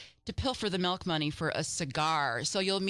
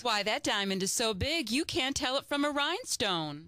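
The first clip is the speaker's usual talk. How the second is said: Molly gushing; 6 semitones higher than usual. Acted. Molly admiring the neighbor's ring, gushing. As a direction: gasp over the ring with delight. Plays Molly in radio scene.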